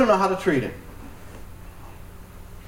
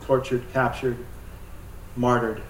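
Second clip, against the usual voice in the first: soft, hushed prayer to the Lord; 6 semitones lower, 8 dB quieter than usual. Acted. Pastor praying softly for prisoners, hushed prayer voice. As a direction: pray quietly over the persecuted.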